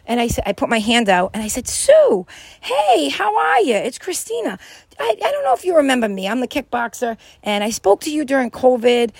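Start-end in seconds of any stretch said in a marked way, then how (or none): 1.7-9.1 high-pitched friendly greeting; a sweet, upbeat hello; sweet and kind. Retold or imitated. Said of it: "I was sweet and kind".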